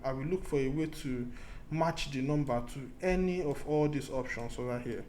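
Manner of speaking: ordinary delivery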